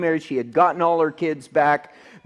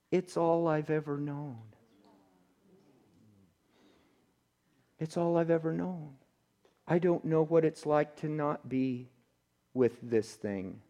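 soft, hushed weeping words; quiet broken voice of a woman in tears; retold weeping; 10 dB quieter than usual. Pastor quotes the weeping woman who fears freedom, hushed and tearful. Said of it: "she just starts weeping".

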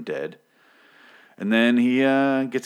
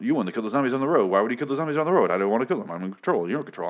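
fast mocking paraphrase; 4 semitones higher, much faster than usual. Mocking characters' dialogue, rattled off.

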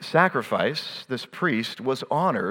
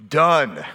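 Done hit firmly and louder; emphatic done; 4 dB louder than usual. Shown as a demonstration. Preacher landing the point, stressed Done. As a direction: land the word Done hard. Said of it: "spelt with four letters".

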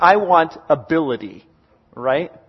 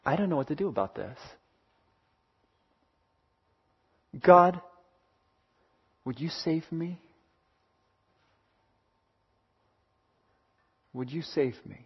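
much softer, faltering plea; despairing inner prayer; 10 dB quieter than usual. Pretend. A troubled listener praying alone for rescue, very soft and despairing. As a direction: let it fall to a desperate near-whisper. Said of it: "And you just think".

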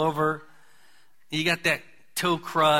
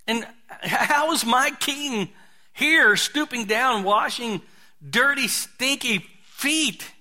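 preaching at full force; 6 semitones higher, slower than usual. Acted. Preacher to congregation, rising slow impassioned appeal. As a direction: hammer home the king stooping to wash feet. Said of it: "preaching builds to climax".

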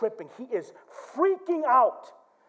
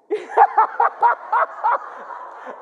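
high, rapid cackle; long breathless giggling cackle; giddy laugh; 7 dB louder than usual. Retold or imitated. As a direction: laugh helplessly instead of answering. Re-enacts laughing at wife's question.

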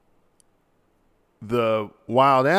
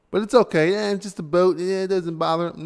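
higher-pitched mock-casual brush-off; sarcastic nonchalance, pitched up; mock dismissive; 7 semitones higher, faster than usual. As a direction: wave it off in a light, high mocking voice. Mocking those who dismiss the intrusion.

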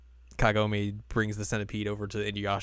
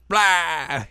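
higher, louder mock battle yell; 7 semitones higher, 7 dB louder than usual. Imitating Kagome, mock yell at the centipede. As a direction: blurt it like a startled blast. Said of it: "Kagome's like".

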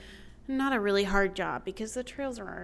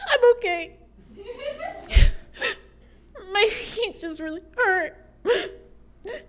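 much higher, tearful voice with a laughing sob, imitating her younger self; tearful crying; 12 semitones higher, 4 dB louder, slower than usual. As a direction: choke out the words half laughing, half crying. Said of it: "And I go".

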